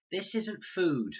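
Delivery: put-on a small boy on the edge of crying; about to cry. A narrator's reading. Max facing raw cocoa, slow and tearful. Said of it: "chin and lip were quivering".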